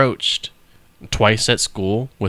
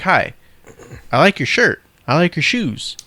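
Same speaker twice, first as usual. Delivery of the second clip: higher, syrupy compliment voice; fake-flattering recruiter; 6 semitones higher, 3 dB louder than usual. Mocking recruiters who flatter strangers, sugary. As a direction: flatter them to reel them in.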